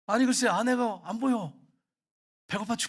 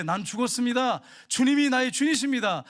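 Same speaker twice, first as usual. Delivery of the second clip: proclaimed higher and louder; bold declaration; 5 semitones higher, 6 dB louder than usual. Shown as a demonstration. Pastor models the believer's declaration, loud and ringing. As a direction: declare it like a confession of faith. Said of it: "이걸 인정하고 사셔야 돼요".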